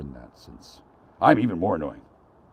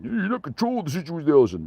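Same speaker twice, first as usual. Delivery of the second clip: mocking voice; 9 semitones higher, 4 dB louder than usual. Mocking the phone dude.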